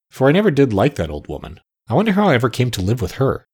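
ordinary delivery

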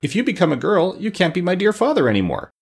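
high-pitched pumpkinhead dismay; distressed, childlike high objection; aghast. A narrator's reading. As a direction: protest in a high, worried voice. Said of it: "said Jack Pumpkinhead with a gasp".

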